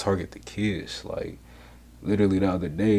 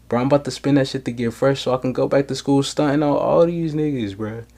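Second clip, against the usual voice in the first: high, loud hyped-up brag; mock boasting; 6 semitones higher, 8 dB louder than usual. Podcaster imagining himself showing off at school, hyped and boastful. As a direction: run it out as an animated, incredulous boast. Acts out what he would do instead.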